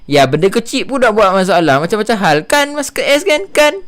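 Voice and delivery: loud, high-pitched Sabahan accent; Sabahan accent attempt. Shown as a demonstration. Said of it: "Whenever I try to do a Sabahan accent".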